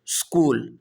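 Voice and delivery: اسکول said skool, opening on the s; اسکول clean, no vowel before it; اسکول demonstrated as skool; correct skool. Shown as a demonstration. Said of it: "اس لفظ کو اسکول پڑھنا چاہیے".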